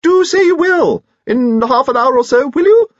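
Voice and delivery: loud, piping, eager invitation; very high squeak. Acted. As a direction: plead at the top of your range over the band. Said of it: "pitching his voice very high".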